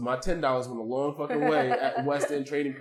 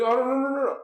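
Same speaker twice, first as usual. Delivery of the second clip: high, louder string of imitated denials; mock protest; 10 semitones higher, 4 dB louder than usual. Quoting the intern declining the craft beer bar, mock protest. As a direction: imitate someone hurriedly waving off an offer.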